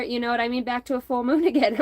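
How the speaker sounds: ordinary delivery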